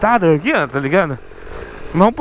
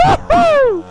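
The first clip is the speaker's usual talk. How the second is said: high whooping cheer; excited whoop. Real, not acted. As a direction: whoop with joy on the ride. Whooping while riding.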